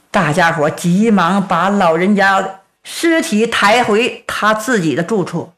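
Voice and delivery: ordinary delivery, nothing marked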